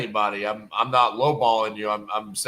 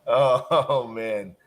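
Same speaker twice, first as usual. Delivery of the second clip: usual speaking voice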